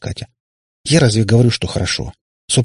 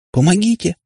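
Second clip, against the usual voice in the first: high, tiny cry of help; mental squeak for help; 10 semitones higher than usual. A narrator's reading. Girl squeaks for help inside the darkness. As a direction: squeak it out in panic. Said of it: "сумела мысленно пискнуть".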